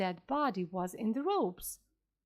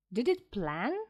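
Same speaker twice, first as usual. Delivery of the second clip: plain reading voice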